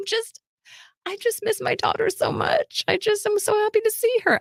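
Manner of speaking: high, weepy imitation of mom; mock tearful. Mom tells the assistant she misses her daughter, mock tearful. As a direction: whimper it in a put-on high voice. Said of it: "my mom is now crying".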